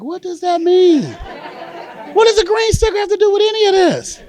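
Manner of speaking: high, loud, drawn-out exasperation; baffled outburst. Acted. Child to mother about the green sticker, baffled outburst. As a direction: cry it out in high-pitched disbelief.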